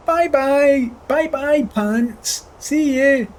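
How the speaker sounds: teasing high goodbye; mock farewell. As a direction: wave the pants off cheerily.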